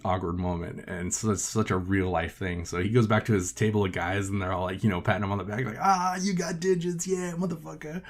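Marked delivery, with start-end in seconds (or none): 5.8-8.0 higher mock bro cheer; cheering buddies. Friends back at the table cheering Mikey, in a higher mock bro voice. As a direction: hype him up like a buddy.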